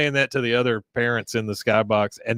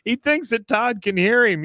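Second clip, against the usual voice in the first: ordinary talk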